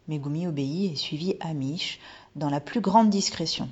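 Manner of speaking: ordinary delivery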